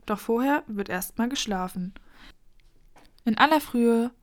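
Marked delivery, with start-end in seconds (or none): none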